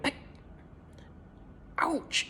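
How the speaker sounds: very high tiny ouch; a hen's squeak of pain; squeaky hen. A narrator's reading. Hen pecking the spoon, very high squeak.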